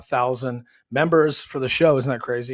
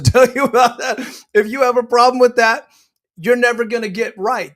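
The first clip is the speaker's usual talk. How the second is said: laughing; 8 semitones higher, 8 dB louder than usual. Real, not acted. Laughing, then ranting.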